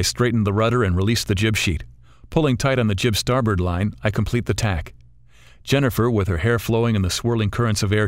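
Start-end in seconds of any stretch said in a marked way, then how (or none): none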